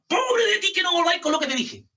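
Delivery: grito de advertencia de padre, más agudo; amenaza de papá. Retold or imitated. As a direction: advierte gritando como papá. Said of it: "si se acuerda de esa palabra". (papá amenazando al hijo con un grito)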